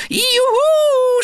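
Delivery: Piggledy leaps into the bath: joyful shout. A narrator's reading. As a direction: whoop as you jump in. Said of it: "schrie Piggledy".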